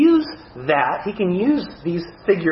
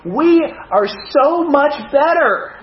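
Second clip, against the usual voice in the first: mock boasting; 6 semitones higher than usual. Preacher parodying his own church's pride to the congregation, mock boasting. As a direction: play smugly superior, stretching the emphatic words. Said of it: "I don't go around talking like this".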